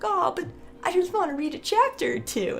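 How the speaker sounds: high pleading whine; whiny plea. Retold or imitated. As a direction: beg like a kid at bedtime. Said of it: "I'm like".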